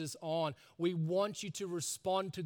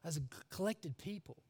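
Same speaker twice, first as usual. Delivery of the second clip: hushed awe; 3 semitones higher, 7 dB quieter than usual. Shown as a demonstration. Preacher shows the tone of meeting with God, awed. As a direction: drop to a reverent hush, stretching the words. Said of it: "a somberness and an awe and an inspiring".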